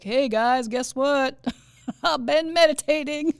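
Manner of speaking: loud, hammed-up Southern announcement; thick Southern accent. Imagined guy telling coworkers he meditates, thick Southern accent. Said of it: "he has a thick Southern accent".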